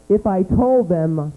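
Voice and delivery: usual speaking voice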